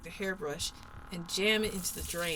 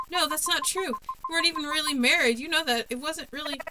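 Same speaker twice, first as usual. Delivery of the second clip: much higher, louder, rushed; desperate protest; 8 semitones higher, 6 dB louder than usual. Acted. Protesting to the ghost that it wasn't real, frantic. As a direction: argue back in a frantic rush.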